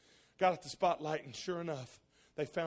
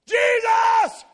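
fervent cry; 20 dB louder than usual. Retold or imitated. Crying out in prayer.

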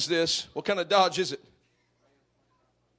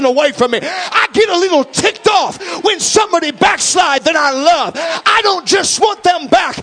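higher, louder, hard-driving preacher; preaching at full force; 10 semitones higher, 12 dB louder than usual. Acted. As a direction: whip the congregation up to a fever. Preaching climax.

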